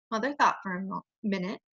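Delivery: ordinary delivery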